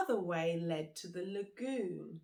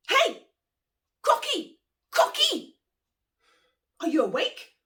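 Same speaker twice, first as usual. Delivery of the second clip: much higher and louder shouts; anxious calling out; 16 dB louder than usual. Acted. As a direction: call out to wake the crocodile. Monkey calls out nervously.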